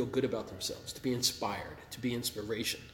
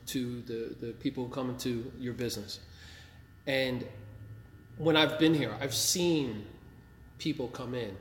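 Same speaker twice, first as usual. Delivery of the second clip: ordinary talk, nothing marked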